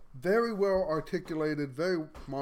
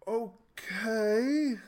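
drawn-out okay, pitched higher; puzzled okay; 6 semitones higher than usual. Retold or imitated. Recalling own reaction to reading Revelations, puzzled okay. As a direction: stretch it out, unsure what to make of it.

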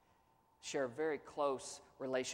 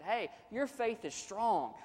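higher, slow, uplifting reassurance; encouraging affirmation; 7 semitones higher, slower than usual. Acted. Pastor voicing encouragement to a struggling believer, higher and slow. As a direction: lift him up with it. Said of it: "words of affirmation like that".

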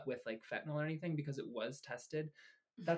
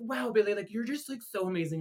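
higher, louder mock gushing; hammed-up drunken adoration; gushing friend; 6 semitones higher, 8 dB louder than usual. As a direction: imitate a friend gushing in admiration.

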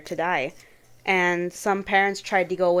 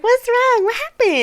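much higher, alarmed quoting; high worried voice; 7 dB louder than usual. Retold or imitated. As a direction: squeak it out in alarm.

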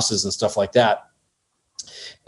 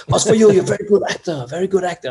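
put-on French star hands the director a gift, high-pitched effusive French accent; high, gushing voice with French accent; effusive French actor; 8 semitones higher, faster than usual. Jean gives David wine, imitated high French-accented effusiveness.

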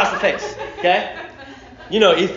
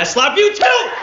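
building to a raised, high cry; defiant outburst; 11 semitones higher, 5 dB louder than usual. Acted. Preacher plays son defying the judge, rising defiant outburst. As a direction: start as an answer, burst out at the end.

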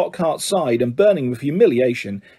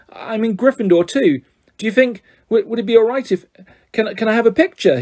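higher, eager and hopeful; hopeful eager boy; 7 semitones higher than usual. A narrator's reading. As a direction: ask shyly but full of hope. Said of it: "raising the camera hopefully".

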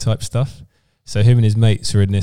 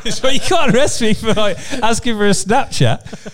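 much higher, laughing through the words; telling a friend the story, laughing high; laughing imitation; 12 semitones higher than usual. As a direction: giggle the protest out. Laughing retelling lad's protest.

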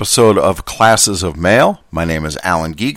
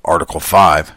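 "Article five" in a mild gruff quoting voice, a bit lower; Dan Carlin gruff voice; 4 semitones lower than usual. Acted. Reading the contract's boxed text, "Article five" lightly gruff. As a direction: say "Article five" like a gravel-voiced history narrator. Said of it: "use his gruff voice that he uses for his quotes".

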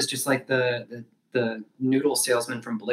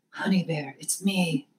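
whispered character voice; 4 semitones higher, slower than usual. Pretend. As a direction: hush it like a secret lover's call. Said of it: "that's the best I can do".

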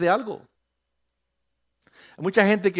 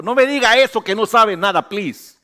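higher, louder pleading rebuke; mock scolding; 6 semitones higher, 8 dB louder than usual. Pastor scolding a member who claims no skills, loud mock rebuke. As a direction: scold them loudly but playfully.

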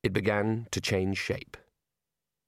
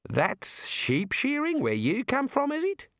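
slow, high disbelieving question; stunned; 13 semitones higher, slower than usual. Acted. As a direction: let the disbelief stretch it out. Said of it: "in a stunned voice".

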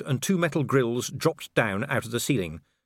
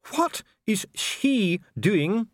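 slow, higher, disdainful question; dripping distaste; 12 semitones higher, 3 dB louder, much slower than usual. A narrator's reading. Official asks about the corporal searching, distasteful. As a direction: linger on SHE with distaste. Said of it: "The pronoun dripped with distaste".

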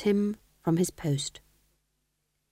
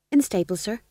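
higher, eager jester patter; jester fool; 4 semitones higher, faster than usual. Acted. Fool replying to the Duke in jester talk, higher. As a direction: chirp it like a court fool.

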